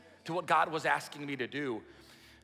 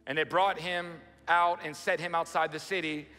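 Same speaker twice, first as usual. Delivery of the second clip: higher, louder, slow Bible reading; dramatic scripture reading; 4 semitones higher, 4 dB louder than usual. Acted. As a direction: proclaim the verses slowly to the church. Reads Genesis aloud to congregation.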